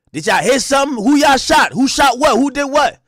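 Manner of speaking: loud, high frantic questions; panicked yelling. Retold or imitated. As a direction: shout it in alarm. Didi panicking on the phone.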